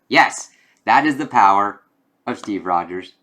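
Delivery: normal talking voice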